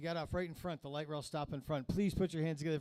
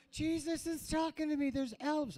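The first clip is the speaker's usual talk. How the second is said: higher voice, hammed-up spaced-out stoner; mock tripping; 11 semitones higher than usual. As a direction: play it like the vape just kicked in. Joking high on vape pen.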